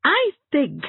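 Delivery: a high, loud exasperated burst. Acted. Anne at the end of her patience with Hazel, exasperated. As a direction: finally lose patience. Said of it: "thoroughly exasperated".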